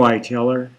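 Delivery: ordinary delivery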